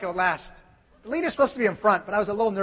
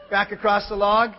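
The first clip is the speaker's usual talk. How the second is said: normal talking voice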